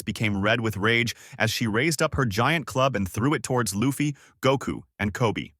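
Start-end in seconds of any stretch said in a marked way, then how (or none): none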